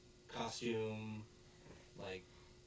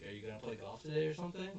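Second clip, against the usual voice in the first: fast, high quick question; quick casual question; 7 semitones higher, 4 dB louder, much faster than usual. Retold or imitated. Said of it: "Onizuka's like".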